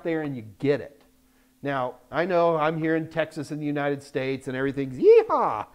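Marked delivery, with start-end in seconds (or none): 5.0-5.7 high, loud cowboy holler; Texan whoop. Acted. Adviser joking about how things are in Texas, whooped cheer.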